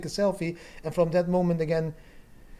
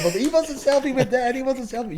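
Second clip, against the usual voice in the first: put-on higher, giggly child voice; excited kids; 9 semitones higher than usual. Young kids teasing dad about the selfie, higher child voice. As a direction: lightly imitate his young kids teasing. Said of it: "My kids started laughing and saying".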